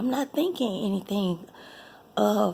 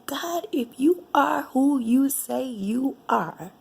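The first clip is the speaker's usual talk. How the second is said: 1 angry plea to God; 5 semitones higher, 5 dB louder than usual. Retold or imitated. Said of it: "And I got angry with God".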